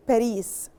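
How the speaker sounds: Paris as "pa-REES"; weight on the long last syllable of Paris, "pa-REES"; Arabic pronunciation. Shown as a demonstration. Teacher giving the Arabic name, Paris as "pa-REES". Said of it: "In Arabic".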